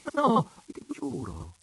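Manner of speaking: high, loud, vivacious protest; lively, hasty denial. A narrator's reading. Daughter swearing to her father, lively denial. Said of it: "s'affrettò a rispondergli Dianella con vivacità".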